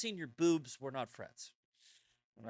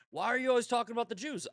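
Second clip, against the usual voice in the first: mock whiny critic; 8 semitones higher, 5 dB louder, much faster than usual. Mimicking critics, laughing.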